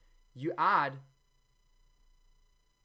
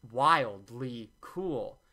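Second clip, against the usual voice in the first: slow drawn-out hype; 5 dB louder, much slower than usual. Real, not acted. Teasing the new ingredient to viewers, slow. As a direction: stretch each word out very slowly, a bit louder. Hyping the mint leaves.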